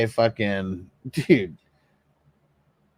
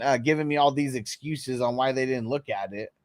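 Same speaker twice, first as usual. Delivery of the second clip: ordinary delivery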